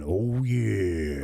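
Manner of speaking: gravelly wrestler "Oh, yeah"; Macho Man voice. Retold or imitated. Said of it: "Macho Man Randy Savage going".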